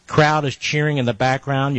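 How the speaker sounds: ordinary talk, nothing marked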